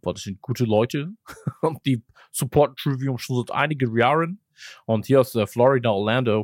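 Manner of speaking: put-on lightly American-accented broken German; a hint of an American rocker speaking clumsy German. Host imitating the band's singer greeting fans, light American accent. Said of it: "als hätte [name] wirklich selbst von sich auch aus gesagt so".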